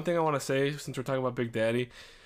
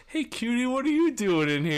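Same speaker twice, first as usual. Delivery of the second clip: high, sweet flirty lady voice; lightly high mock woman; lady's voice; 11 semitones higher, 4 dB louder than usual.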